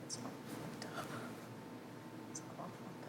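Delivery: barely voiced, quiet protest; whispered denial. Real, not acted. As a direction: breathe it out softly. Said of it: "quiet denial".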